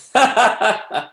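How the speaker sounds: high burst of laughter; laughing. Real, not acted. Teacher amused by a student's question, laughing. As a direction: break into a high-pitched laugh.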